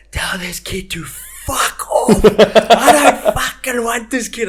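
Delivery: high, slow, rising to a shout; mock scream. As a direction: imitate the coach yelling in disbelief. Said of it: "Darren screaming at Jack Lloyd".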